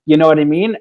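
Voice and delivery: ordinary talk, nothing marked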